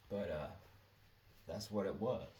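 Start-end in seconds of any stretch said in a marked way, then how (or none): none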